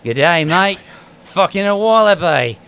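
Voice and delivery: mock Australian accent. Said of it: "he kinda talks like this".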